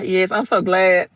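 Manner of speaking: ordinary talk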